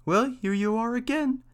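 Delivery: high mouse voice; mouse character. A narrator's reading. Mouse greets the returning cat, high-pitched. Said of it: "said the mouse".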